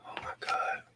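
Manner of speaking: breathless whispered dismay; despairing whisper. Real, not acted. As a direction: whisper it in defeat. Groaning at trade result.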